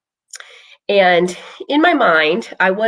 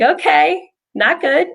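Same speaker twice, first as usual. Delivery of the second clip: high-pitched note to self; mildly alarmed self-talk, higher; retold mild worry; 9 semitones higher, slower than usual. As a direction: pitch it up, uneasy but holding on. Retells her rising worry.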